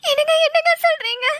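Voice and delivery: tearful, halting disbelief; overwhelmed wife, voice lifted by tears; happy crying. Acted. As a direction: sob with joy between short phrases. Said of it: "இந்த நேரத்துல நீ அழக்கூடாது".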